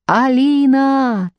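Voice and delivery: high, long-drawn shout of a name; calling over wind. A narrator's reading. Mal calling Alina over the wind, shouting. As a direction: stretch the name out to carry. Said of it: "пытался перекричать потоки ветра".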